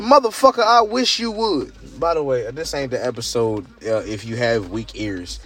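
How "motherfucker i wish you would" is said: daring threat. Retold or imitated. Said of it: "and I said".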